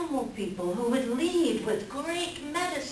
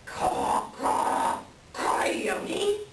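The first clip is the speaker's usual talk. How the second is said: breathy caws that break into a high whispered gasp of the name; choking sputter; 9 semitones higher, much slower than usual. Acted. Raven nearly falls from his perch, choking on the name. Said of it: "chokes and sputters on that name".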